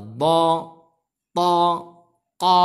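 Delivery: "bo, to, ko" with rounded, heavy o; O vowel. Shown as a demonstration. Teacher lists heavy letters, "bo, to, ko" with O vowel. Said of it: "pakai vokal O".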